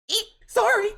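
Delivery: high, loud startled eek then sorry; startled squeak. Acted. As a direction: caught off guard, squeal it out. Startled girl apologizes.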